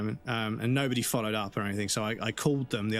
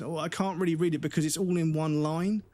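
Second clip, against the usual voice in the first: higher-pitched, whiny imitation of an excuse; mocked doctor; 5 semitones higher than usual. Patient imitating the doctor who can't read the screen, mocking. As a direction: mimic a helpless doctor making excuses.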